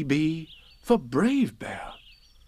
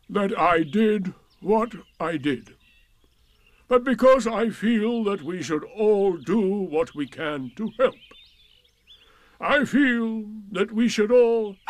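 pompous Eeyore; 9 semitones higher, 5 dB louder than usual. A narrator's reading. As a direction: make a laboured, self-important speech. Eeyore resumes his speech.

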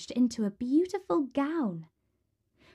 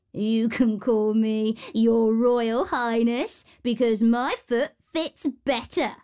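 second horrid sister; 3 dB louder than usual. A narrator's reading. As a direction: lord it over everyone, drawing it out. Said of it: "said the second".

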